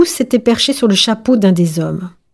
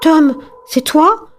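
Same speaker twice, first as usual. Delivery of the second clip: higher, questioning parent voice; raised, astonished questions; hopeful disbelief; 6 semitones higher, slower than usual. A narrator's reading. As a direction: ask as if hardly daring believe it. Parents recognise their lost child.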